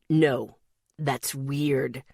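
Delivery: slow, low and rough; gravelly rough voice. Acted. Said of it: "his gravelly voice rough".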